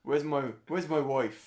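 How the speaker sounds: loud, slow, hammed-up film hero; Liam Neeson. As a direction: shout it as a mock movie line.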